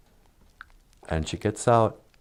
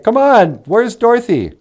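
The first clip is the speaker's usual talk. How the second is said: farmhands calling; 10 semitones higher, 4 dB louder than usual. Retold or imitated. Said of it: "They're like".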